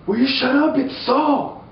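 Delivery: high voice, urgent hush; high hissed shush. Retold or imitated. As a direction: shush them, panicked and high. Mighty men whispering.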